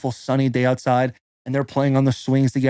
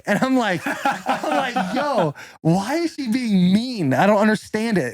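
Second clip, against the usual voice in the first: laughing; 8 semitones higher, slower than usual. Real, not acted. Laughing while telling wife story.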